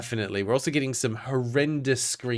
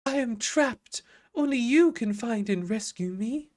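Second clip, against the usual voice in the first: much higher, otherworldly plea from a trapped sorceress; ghostly dream voice; 11 semitones higher than usual. Acted. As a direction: call out like a distant trapped spirit. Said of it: "very Star Wars-ish".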